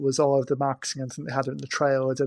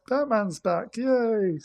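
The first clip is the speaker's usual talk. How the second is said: mock cheer; 8 semitones higher, much slower than usual. Mock cheering for Batman's return.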